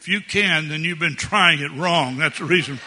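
high, loud preacher's exhortation; full-force pulpit exhortation; fiery preaching. Acted. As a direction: preach it hard to the parents. Preacher building to loud punchline.

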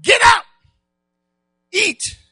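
high, loud call, words spaced out; angel's urgent call. Acted. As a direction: rouse the sleeping prophet. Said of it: "touched him and said".

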